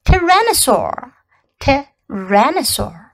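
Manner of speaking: Tyrannosaur said slowly, syllable by syllable; 慢慢示范Tyrannosaur的发音; teaching pronunciation. Shown as a demonstration. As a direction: model the word Tyrannosaur clearly twice for kids. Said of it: "还记得怎么说吗".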